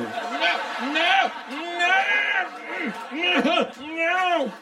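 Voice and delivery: high-pitched protest; high squeaky no. Real, not acted. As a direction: squeal the refusal. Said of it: "high protesting nos".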